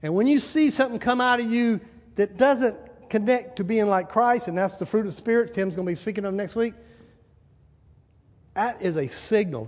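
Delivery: usual speaking voice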